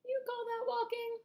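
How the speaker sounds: high, loud disbelieving cry; incredulous cry. A narrator's reading. Centipede mocking the earthworm, high incredulous cry. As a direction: scoff at the very idea of walking. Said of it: "cried the centipede".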